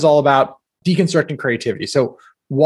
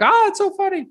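much higher mock gushing voice; mock casting crew. Casting staff reacting to his Elmo, mock high gush. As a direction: squeal it like amused casting staff. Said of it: "they were like".

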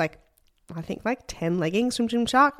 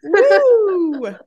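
loud, high whoop; excited cheer; 13 semitones higher, 7 dB louder than usual. Real, not acted. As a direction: whoop for joy. Cheering friend in Vegas.

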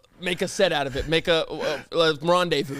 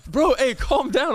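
higher, urgent calming; alarmed; 7 semitones higher, slower than usual. Pretend. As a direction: rush in to calm him down. Consoling the fake crier.

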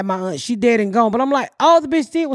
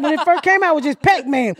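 ordinary delivery

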